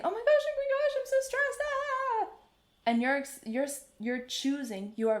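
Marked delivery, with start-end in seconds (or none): 0.0-2.3 much higher, louder mock-frantic squeal; panicky backstage friend. Nervous friends backstage, high frantic mock squeal. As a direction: shriek it like a stressed friend before going on. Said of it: "They are saying".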